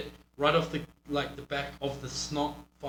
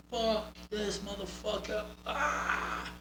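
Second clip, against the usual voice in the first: higher, softer, intense fired-up curse; fired-up taunt; 6 semitones higher, 6 dB quieter, much slower than usual. Shown as a demonstration. Coach showing wrestler how to fire up, intense and higher. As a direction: sell the fight, then cry out. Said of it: "You need to be like".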